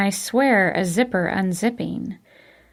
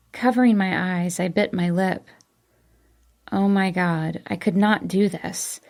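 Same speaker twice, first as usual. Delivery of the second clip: plain reading voice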